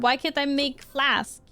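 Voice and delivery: normal talking voice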